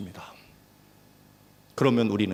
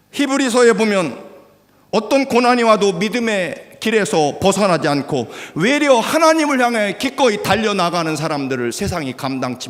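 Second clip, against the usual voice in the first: loud, high, surging sermon delivery; fiery preaching; 9 semitones higher, 7 dB louder than usual. Real, not acted. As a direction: build it up and shout it out. Preaching at full force.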